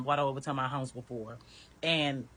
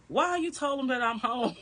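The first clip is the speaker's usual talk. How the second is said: mimicking him; 9 semitones higher, 3 dB louder than usual. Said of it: "And so he starts saying".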